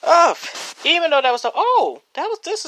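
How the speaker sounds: excited. Real, not acted. Gushing over the category.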